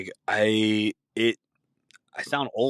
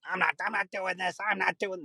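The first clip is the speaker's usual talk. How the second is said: high, quieter mock grumbling refusal; stubborn old person; 10 semitones higher, 4 dB quieter than usual.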